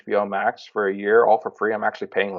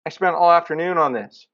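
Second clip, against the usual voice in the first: exasperated complaint; 7 semitones higher than usual. Retold or imitated. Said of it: "I'm like".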